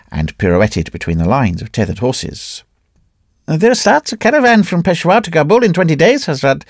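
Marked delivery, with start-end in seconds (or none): 3.5-6.6 much higher, respectful call; trader addressing priest. A narrator's reading. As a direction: offer the priest a place in the caravan.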